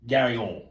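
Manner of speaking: "Gary on" with the ending swallowed; mocking mispronunciation. Host mocking how orange gets said, slurred "Gary on". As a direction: say "Gary on" with a blurred last word. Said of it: "the way you say".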